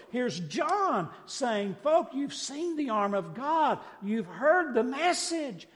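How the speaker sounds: full-force preaching. Real, not acted. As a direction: build to a loud, exultant climax.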